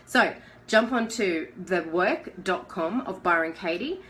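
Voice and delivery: ordinary talk, nothing marked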